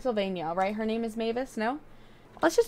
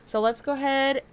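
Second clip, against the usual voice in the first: usual speaking voice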